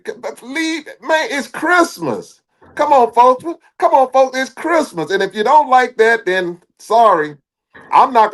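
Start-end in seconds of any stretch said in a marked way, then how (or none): 0.0-2.4 exasperated plea. Real, not acted. Pleading it's Christmas, high voice. 2.7-3.4 louder plea. Real, not acted. Repeated plea to fans. 3.8-5.1 exasperated plea. Real, not acted. Pleading it's Christmas, high voice.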